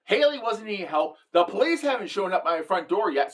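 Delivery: usual speaking voice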